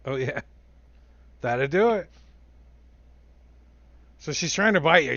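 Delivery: usual speaking voice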